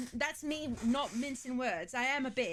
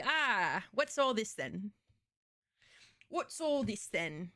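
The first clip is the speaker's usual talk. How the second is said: put-on British accent; slower than usual. British bobby impression of arm ripped.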